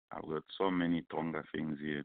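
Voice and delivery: usual speaking voice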